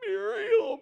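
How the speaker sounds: high, loud, squealing call; cartoon dog yelp. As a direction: shriek the name like a panicked cartoon dog. Calling out as Courage character.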